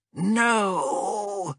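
high strained gasp of No!; a wounded creature's desperate gasp; gasped cry. Acted. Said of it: "gasped the wounded Saurian priest".

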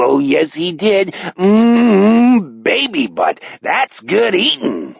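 high, drawn-out villain voice; gloating cannibal fisherman. Acted. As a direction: savor every grisly word, mostly pitched high. Fisherman gloats while eating fingers.

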